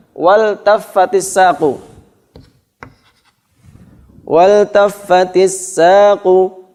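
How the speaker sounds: Wal taffatis saqu with wal pronounced fully, slow; clear lam before verb. Shown as a demonstration. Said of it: "alnya dibaca izhar".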